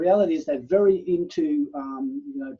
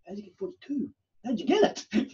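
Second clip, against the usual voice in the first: baffled, imitating himself; 9 semitones higher than usual. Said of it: "I'd go".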